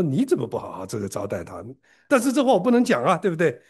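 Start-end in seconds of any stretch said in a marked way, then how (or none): 0.0-1.6 lower, muttered sarcastic echo; low mocking echo kept to oneself; inner retort. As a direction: throw his words back under your breath. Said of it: "我就心里想说".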